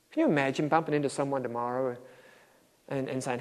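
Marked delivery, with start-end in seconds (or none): none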